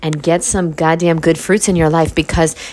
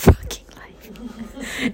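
whisper; 7 semitones higher, 6 dB quieter, much slower than usual. Real, not acted. Whispered swear.

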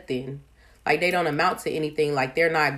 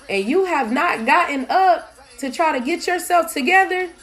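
put-on raised, much higher voice, scolding the kid; exasperated scolding; 11 semitones higher, 4 dB louder than usual. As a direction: call out at the child still in bed, fed up. Mom scolding kid who's not up.

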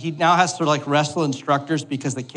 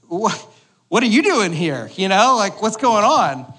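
higher, louder mock-alarmed questions; mock startled; 6 semitones higher, 4 dB louder than usual. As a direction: play the startled dad, a bit hammed-up. Dad startled by daughter.

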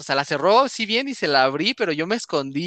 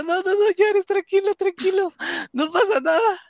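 high, rushed pleading to calm him; high nervous "calm down" to a terrified little brother; frantic reassuring; 12 semitones higher than usual. Retold or imitated. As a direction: soothe him in a high panicky voice. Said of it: "Y yo".

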